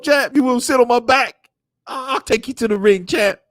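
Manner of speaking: high, loud taunting voice; mocking cheerleader voice. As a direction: squeal 'champ' high to taunt him.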